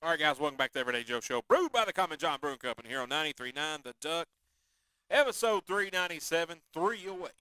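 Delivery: loud, brisk announcer patter; big radio host intro; radio show welcome-back. Acted. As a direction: welcome listeners back with punch.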